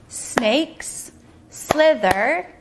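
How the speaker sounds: "Snakes slither" very slow, beat by beat; syllable clapping. Shown as a demonstration. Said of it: "Let's clap our syllables".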